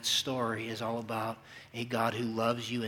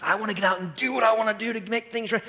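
high, loud, rushed protest; acted impatient yell, racing along; frantic outburst; 11 semitones higher, 9 dB louder, faster than usual. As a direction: burst out in an agitated, high-pitched rush. Acts out restless believer's protest.

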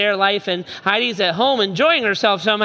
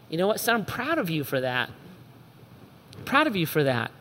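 much softer, gentle praise; tender pride; 5 semitones lower, 9 dB quieter than usual. Retold or imitated. Parents telling their son they're proud, soft tender praise. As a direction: tell your son gently you're proud. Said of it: "we look and say".

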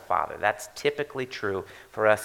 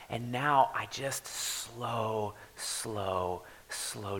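dragged slow, fading away; drawn-out slow; 6 dB quieter, much slower than usual. Retold or imitated. Pastor quoting a churchgoer, slowing right down. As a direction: stretch the word slow, softening. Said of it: "they're saying".

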